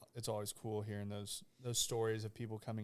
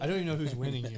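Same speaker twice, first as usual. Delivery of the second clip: laughing; 3 semitones higher, 9 dB louder than usual. Real, not acted. Joking about brothers arguing.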